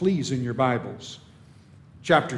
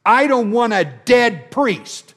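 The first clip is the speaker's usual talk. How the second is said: emphatic outburst; 6 semitones higher, 6 dB louder than usual. Real, not acted. Preacher rejects the flawed priesthood.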